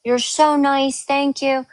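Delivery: high, sugary thank-yous; laying on the gratitude to the agent; gushing thanks. Retold or imitated. Speaker to the phone agent, gushing gratitude.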